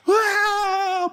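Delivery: high, drawn-out mock cry; fake wail. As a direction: wail as a hired mourner would. Imitating fake mourners wailing. (tangisan pura-pura melengking)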